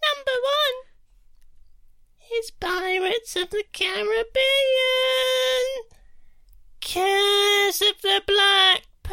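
put-on creepy child ghost. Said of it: "I'll do Creepy Child Ghost".

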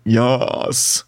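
low, drawn-out creature voice; deep guttural voice. Acted. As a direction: answer like a deep-throated creature. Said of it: "a deep guttural voice that says".

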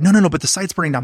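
fast, rushed, mock frantic protest; frantic coworker. Coworker protesting at the desk, mock frantic and fast. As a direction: blurt it out like the site is on fire. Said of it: "And they're like".

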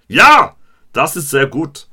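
"Ja!" shouted high and loud, then "Das ist sehr gut" in a mock German voice; hammed-up classroom German; shouted school German. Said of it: "my G C S E German coming out".

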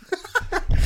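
high loud laugh; burst of laughter. Real, not acted. Laughing at own accent attempt.

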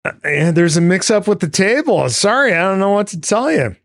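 put-on high, hurried fib to his girlfriend; Sean playing it off. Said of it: "he's gotta try to play things off as".

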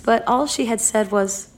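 plain reading voice

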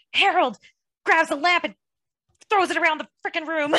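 high, loud frantic retelling; frantic freak-out. Retold or imitated. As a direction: burst out in a panic. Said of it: "He's like".

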